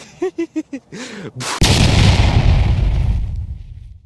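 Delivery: loud high breathless laughter; breathy laugh. Real, not acted. Birdie dance.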